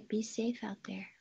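soft unvoiced whisper. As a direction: breathe the words, no voice. Quoting Shar's sign-off softly.

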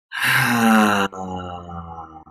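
long open-mouthed sigh out; loud relieved ha breath; audible ha exhale. Shown as a demonstration. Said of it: "let a ha sound out".

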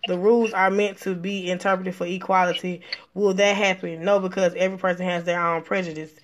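low, soft read-out of viewer comments; reading comments aloud. Real, not acted. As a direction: read it off the screen, dropped low and quiet.

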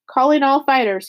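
shouting. Acted. Tick calling fighters down the hotel hall, mild rallying shout. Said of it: "he shouted".

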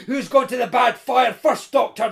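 fierce, gloating challenge; snarling. A narrator's reading. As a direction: snarl it at a beaten enemy. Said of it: "the intruder was snarling".

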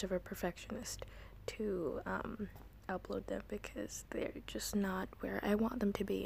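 soft whispered intro. Real, not acted. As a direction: hush your voice to a breathy whisper for the listeners.

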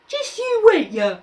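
high mocking parody whine. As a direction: mimic the whine to mock. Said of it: "Jack mimicked the whine".

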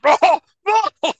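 loud hammed-up dying gasp; mock dying cry. Mocking the dying cop's loud cry. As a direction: ham up a movie death cry. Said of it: "And he's like".

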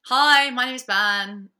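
slow, loud put-on greeting; mock introduction. Mocking the ex's introduction, slow and loud. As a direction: announce yourself loudly and slowly like the ex.

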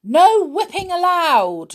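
high, loud stern shout; shouting pixie. Acted. Pixie in charge shouts at Winks on the roundabout, loud stern shout. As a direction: bellow the rule at the rule-breaker. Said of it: "shouted the pixie in charge".

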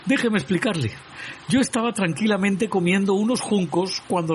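high, frightened, pleading squeak. A narrator's reading. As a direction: beg for mercy in a high scared voice. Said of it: "todo asustado, no podía apenas balbucear". (voz aguda y asustada, suplicando)

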